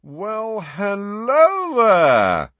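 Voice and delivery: high, long drawn-out hearty greeting; vain celebrity. A narrator's reading. Former teacher greeting supposed fans, flamboyant vain celebrity. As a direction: swell into a showy, loud hello.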